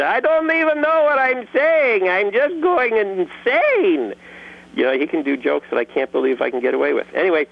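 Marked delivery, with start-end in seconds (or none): 0.0-4.1 high, strained weasel-like character voice; Mister Lunt voice. Acted. Mister Lunt gleefully admitting he is going insane. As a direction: squeak it out like a manic cartoon sidekick. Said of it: "And Mister Lunt, he says".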